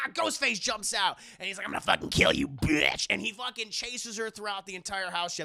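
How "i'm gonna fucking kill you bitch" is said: loud, low mock villain threat; threatening killer. Ghostface attacking from the closet, threatening. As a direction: growl the threat like a movie killer.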